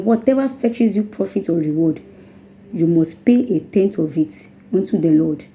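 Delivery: normal talking voice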